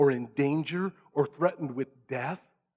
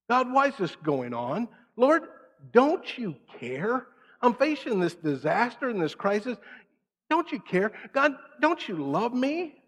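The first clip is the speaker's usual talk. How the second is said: whining prayer; 8 semitones higher than usual. Retold or imitated. Voicing a complaining believer.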